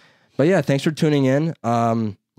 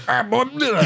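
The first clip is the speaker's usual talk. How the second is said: mock German; 13 semitones higher than usual. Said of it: "some shit in German".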